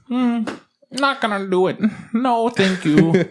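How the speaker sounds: high, slow prissy refusal; mock stubborn old lady. Old tenant refusing the settings, high and prim. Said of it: "she's just like".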